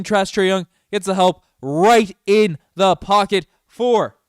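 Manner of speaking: loud, high, slow elated yelling; screaming excitement. Real, not acted. Fan streamer going wild over a pass, screaming. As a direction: lose it over the play.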